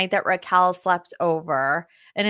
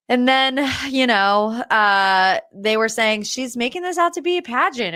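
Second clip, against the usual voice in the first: ordinary talk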